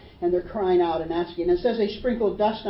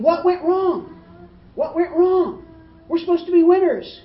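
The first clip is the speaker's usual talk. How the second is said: high, loud cries of disbelief; dying men crying out in shock; panicked soldiers; 8 semitones higher, 6 dB louder than usual. Retold or imitated. Preacher voicing dying soldiers, high and loud. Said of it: "can you imagine what they're thinking".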